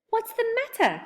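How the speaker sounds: high, startled question to the crying fairy; alarmed cry. Acted. Joe finds Silky crying, startled high cry. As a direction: burst out in surprise, pitched high. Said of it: "cried Joe".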